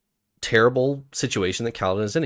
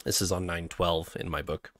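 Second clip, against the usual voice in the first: ordinary talk, nothing marked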